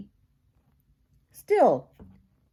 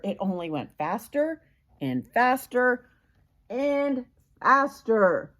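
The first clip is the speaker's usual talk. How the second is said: rising higher and louder with each faster; building excitement; 6 semitones higher, 3 dB louder than usual. A narrator's reading. Reading to a child, mounting from plain to loud high alarm. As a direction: build it up as the mixer runs away. Mixer spinning out of control.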